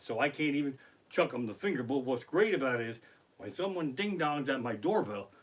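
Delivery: usual speaking voice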